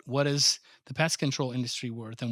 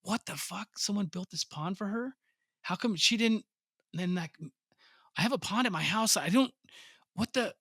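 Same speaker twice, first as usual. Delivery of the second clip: retold disbelief; 5 semitones higher than usual. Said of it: "and I'm like".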